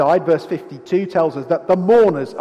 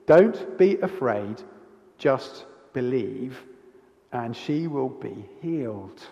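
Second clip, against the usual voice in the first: slow and softer, like a calm promise; gentle reassurance; 5 semitones lower, 4 dB quieter, slower than usual. Retold or imitated. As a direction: ease the father's fear softly and slowly. Jesus reassures grieving father.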